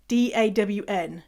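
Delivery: ordinary talk, nothing marked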